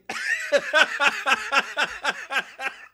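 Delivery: high, full cackle; laughing. Real, not acted. Co-host cracking up at ring joke, high cackle.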